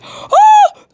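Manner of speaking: very high, loud startled "oh"; sudden squeal. Real, not acted. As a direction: squeal out a short high whoop.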